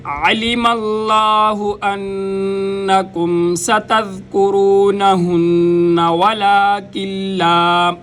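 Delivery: slow melodic tajweed chant; reciting the verse in full after the lesson; Quranic recitation. Acted. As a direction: draw each vowel out as in recitation.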